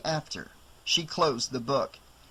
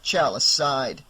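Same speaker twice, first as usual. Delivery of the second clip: ordinary delivery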